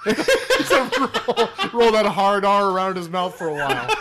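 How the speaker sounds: loud, high laughing tease. Real, not acted. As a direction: laugh through the teasing. Laughing while teasing.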